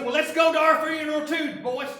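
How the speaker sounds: loud, high sarcastic jab at the plan; scoffing loud call to the other disciples; sarcastic shout. Acted. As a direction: throw it out like a bitter joke. Cynical disciple mocking Jesus' plan.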